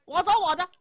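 high, quick and scornful; huffy drunken bravado; pouting dismissal. Acted. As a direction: toss it off with a pout. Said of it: "姚琦把嘴一撇".

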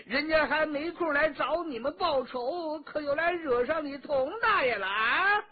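high-pitched, sustained angry yell; furious high-pitched challenge; angry cursing shout. Acted. Character curses out his foes.

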